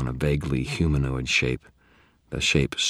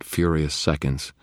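ordinary delivery

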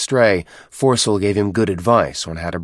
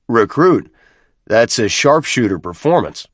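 louder, higher commanding officer; captain's voice; 3 semitones higher, 4 dB louder than usual. Acted. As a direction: address the recruit with authority. Captain praises recruit's score.

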